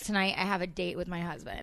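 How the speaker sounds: ordinary talk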